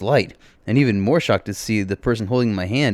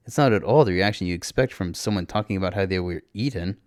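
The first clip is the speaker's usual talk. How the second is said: ordinary delivery, nothing marked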